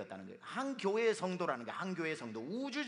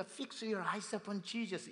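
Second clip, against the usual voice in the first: slow, higher declaration; solemn slogan; proclaiming title; 6 semitones higher, much slower than usual. Acted. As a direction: announce it slowly. Quoting sermon title.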